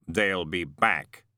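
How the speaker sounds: slow, echoing, otherworldly voice; deep calm of an immortal; voice of Death. A narrator's reading. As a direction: speak as the ancient angel of death, unhurried. Death speaks.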